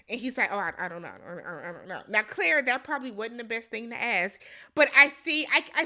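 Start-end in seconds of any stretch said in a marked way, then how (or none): 0.5-2.0 low, fading stammered mumble; mock floundering. Host imitating the husband asked about his father, mock floundering mumble. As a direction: trail off into soft, helpless repetition.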